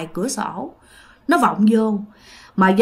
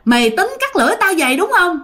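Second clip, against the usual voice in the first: lightly voiced ghost woman, pitched up; ma nữ trách móc; 6 semitones higher than usual. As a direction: accuse him in a high, plaintive voice. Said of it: "nó than thở xong cái nó thấy chú dòm nó". (giọng ma nữ hơi cao, hỏi tội)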